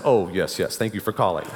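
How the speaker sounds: put-on teen faking his dad's deep voice on the phone. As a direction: drop the voice to pass as your father. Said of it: "I lowered my voice and said".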